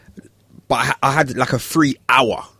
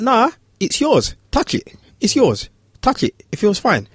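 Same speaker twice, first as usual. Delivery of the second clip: high, gentle sing-song coaxing; coaxing child; 10 semitones higher than usual. Retold or imitated. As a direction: barber coaxing the scared boy to touch the clippers.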